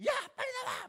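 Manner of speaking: very high, loud shout; angry yell. Retold or imitated. Wife ordering hiding daughter out for eye medicine, angry shout. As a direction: yell like an exasperated parent calling a hiding child out. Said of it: "소리를 질렀었거든요".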